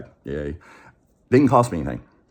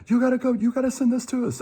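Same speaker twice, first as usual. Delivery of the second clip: much higher, mock insistent caller voice; pushy scammer. Scammer orders the caller to pull up the form, high mock voice. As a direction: lay on the fake outrage, high-pitched. Scammer pressing to send money.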